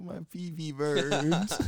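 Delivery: put-on high, slow child's whine; whiny little kid. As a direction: drag it out like a hurting little boy. Kid voice retold.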